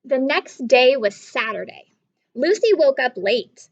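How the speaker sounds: ordinary talk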